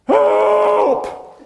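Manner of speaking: high, loud cry of help; hammed-up panic shout; mock scream for help. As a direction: yell it like a drowning sailor. Acting terrified disciples screaming.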